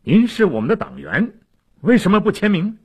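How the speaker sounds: high, forceful demand; pointed, high-pitched interrogation; stern questioning. A narrator's reading. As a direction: press him hard for an answer. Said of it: "黑尔巴哈问他".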